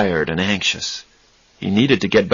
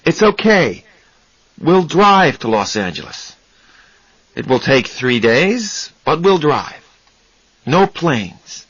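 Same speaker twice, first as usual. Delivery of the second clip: reassuring; 6 semitones higher than usual. Acted. Charlie calming panicked Raymond.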